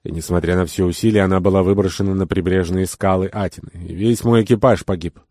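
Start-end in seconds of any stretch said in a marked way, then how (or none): none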